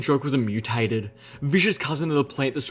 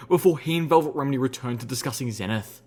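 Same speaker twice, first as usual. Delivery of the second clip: ordinary delivery, nothing marked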